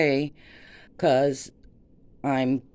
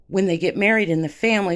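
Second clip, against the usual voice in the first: ordinary talk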